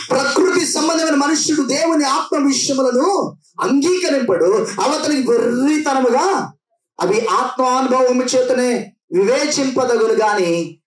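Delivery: high, slow, intoned scripture; solemn scripture reading. Acted. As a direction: intone the verse slowly and high. Reading Paul's words.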